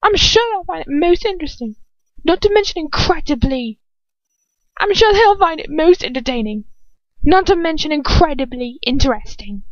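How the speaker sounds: high, sing-song threat; menacing taunt. Acted. Bellatrix threatens to expose Lucius, mocking and high. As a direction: savor the blackmail.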